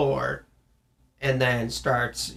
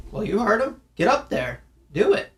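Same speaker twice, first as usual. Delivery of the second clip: much higher, teasing parent voice; mock parent egging on kid; 8 semitones higher than usual. Parent to kid told off by coach, high teasing voice. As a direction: playfully goad the child to take the challenge. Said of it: "I'd be like".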